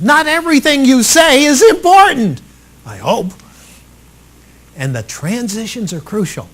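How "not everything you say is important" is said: much higher and louder, punched; emphasized. Shown as a demonstration. Coach showing strong emphasis. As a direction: lean hard on this line. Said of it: "Emphasize certain points".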